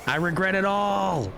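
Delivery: high, wailing comic dismay; high-pitched regret. Real, not acted. Pilot after crashing in debris, high-pitched wail.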